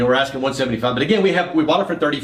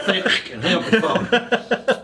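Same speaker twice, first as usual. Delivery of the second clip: higher, chuckled thanks; a laughing dry sign-off; 6 semitones higher, slower than usual. Retold or imitated. Mitch ending the phone call, laughed through. Partner's curt reply, retold with laughter.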